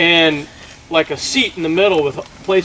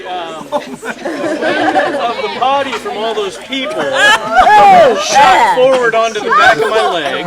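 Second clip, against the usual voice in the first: words pushed out through laughter; can barely get it out for laughing; high, breaking laugh through the words; laughing while telling; 9 semitones higher, 3 dB louder than usual. Real, not acted. Laughing through embarrassing story.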